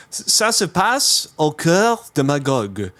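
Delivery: French label read aloud. Real, not acted. Podcaster reads a beer label all in French, French pronunciation. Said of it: "this is all in French".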